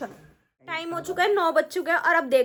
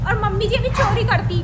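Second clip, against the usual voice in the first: ordinary talk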